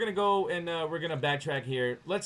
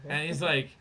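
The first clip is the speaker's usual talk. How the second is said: ordinary delivery, nothing marked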